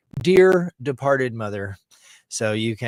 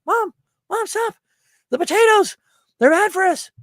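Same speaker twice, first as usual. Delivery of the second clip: put-on high, loud child voice; alarmed little kid; 8 dB louder than usual. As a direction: imitate a kid urgently warning mom. Acts himself as panicked kid.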